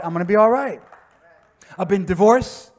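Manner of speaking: usual speaking voice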